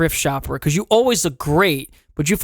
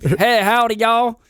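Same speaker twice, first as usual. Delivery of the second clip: higher-pitched Southern howdy; mock Southern accent; 6 semitones higher, slower than usual. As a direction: greet folks in a put-on Southern drawl. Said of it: "picking up a Southern accent".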